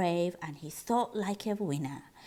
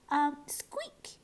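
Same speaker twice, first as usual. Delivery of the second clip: nervous squeak; 4 semitones higher than usual. Acted.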